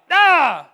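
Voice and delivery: sudden high, loud "Ah!"; demon's cry. Retold or imitated. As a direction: imitate a screaming demon's outcry. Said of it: "he just has this, like, cry".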